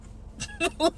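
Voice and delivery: soft breathy laugh; imitated breathy chuckle. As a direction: puff out a quiet chuckle. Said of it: "he low-key starts laughing".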